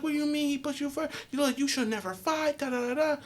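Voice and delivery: high mock mom lecture; imitating mom scolding, pitched high; mom lecturing. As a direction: nag like a mother. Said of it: "And she was like".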